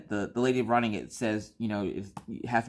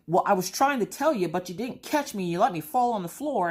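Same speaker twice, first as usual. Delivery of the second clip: whiny complaint; 9 semitones higher than usual. Retold or imitated. Wife to husband who didn't catch her, whining high. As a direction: whine at him for letting you fall. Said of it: "I'm like".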